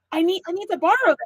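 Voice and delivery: high, rushed and excited; re-enacted breathless eagerness; eager pleading. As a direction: blurt out an urgent want. Retells eager request to husband.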